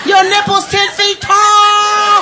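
drawn-out, high indignant yell; slow shout. Retold or imitated. Acted quarrel, slowed shout.

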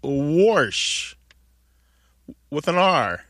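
"wash" said as warsh, R before the sh; intrusive R. Shown as a demonstration. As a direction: stretch "wash" out with an added R. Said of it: "your mother says wash with an R".

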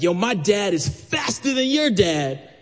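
high, slow schoolyard boast; bragging kid. Retold or imitated. A kid at school brags to the narrator, high and slow.

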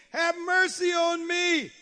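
high, long-held plea; crying out. Retold or imitated. Woman pleading to Jesus, crying out. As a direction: call it out high and drawn out.

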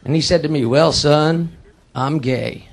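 low, quiet storytelling voice; subdued confession. Acted. Son telling his father about the skydive, low subdued voice. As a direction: recount it low and under-powered.

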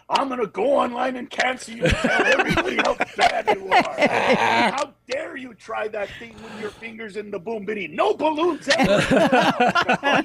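high hammed-up indignant rant; outraged lover. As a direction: threaten to cancel them, mock offended.

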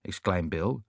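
ordinary delivery

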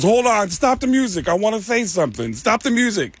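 high, urgent put-on voice; high-pitched insistent imitation; Tracy impression. Retold Tracy halting his karaoke.

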